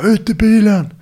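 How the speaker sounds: retold police command, shouted up high; imitating a cop's shout. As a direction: mimic the cop yelling at him. Plainclothes cop orders him out.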